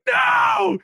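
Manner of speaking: high-pitched, drawn-out mock scream of protest; high hammed-up wail of disbelief; retold scream. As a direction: yell it at the podcast in comic outrage. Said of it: "screaming at the wall".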